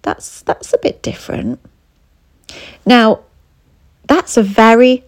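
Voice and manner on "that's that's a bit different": soft, half-whispered sniffy remark; subtle put-down. Retold or imitated. Partner eyeing your new look, quiet sniffy put-down. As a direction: drop to a quiet, disapproving murmur. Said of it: "they look at you and they go".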